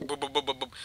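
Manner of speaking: quick, soft, repeated bumping noise; imitated bumping. Wheeling her through park gravel, imitated soft bumpy boops. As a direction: mimic wheels bouncing over gravel. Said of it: "I'm wheeling her, like, through the gravel".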